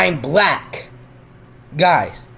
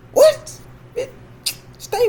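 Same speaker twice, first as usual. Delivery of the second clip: incredulous shout; slower than usual. Real, not acted. Incredulous reaction.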